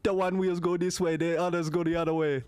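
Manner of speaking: a hint of Jamaican, explaining; Jamaican accent. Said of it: "Jamaican, my cousin Vinny".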